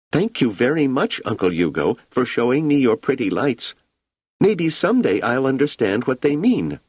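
put-on high, polite child voice; a sweet girl thanking her uncle; grateful little girl. A narrator's reading. Wanda thanks Uncle Hugo for the pretty lights.

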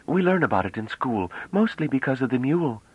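put-on high, bright-eyed girlish voice, full of wonder; young woman Bliss, eager. A narrator's reading. Young woman marvelling at the ship, high and excited.

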